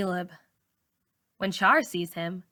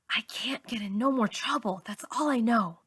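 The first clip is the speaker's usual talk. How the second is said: breathy hushed whisper; 3 semitones higher than usual. A narrator's reading. Malika whispering to herself about trouble. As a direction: whisper it so nobody hears. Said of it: "I whisper".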